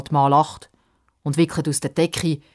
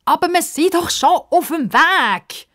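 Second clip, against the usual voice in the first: put-on protesting girl; 14 semitones higher, 6 dB louder than usual. Girl protests to old man.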